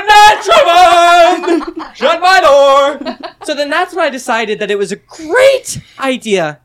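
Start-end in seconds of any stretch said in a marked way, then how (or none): 0.1-3.2 slow, high, drawn-out taunt broken by laughter; laughing taunt. Retold or imitated. Taunting Trayvon at the end of the video, slow laughing drawl. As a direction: drag it out mockingly, laughing.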